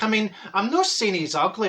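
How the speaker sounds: ordinary talk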